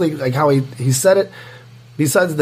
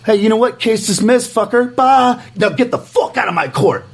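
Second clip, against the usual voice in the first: higher, mocking judge voice; imitating the judge; 7 semitones higher than usual. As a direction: quote the judge, high and scornful. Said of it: "The judge goes like this to me".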